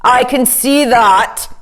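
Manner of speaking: king's retort. A narrator's reading. King replies curtly.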